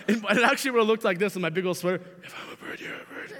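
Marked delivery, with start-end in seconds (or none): 2.2-3.3 whispered with a laugh breaking through. Retold or imitated. Boy redoing his whispered love line, laughing. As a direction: whisper it and crack up. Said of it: "I whisper it".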